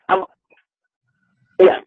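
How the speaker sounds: ordinary talk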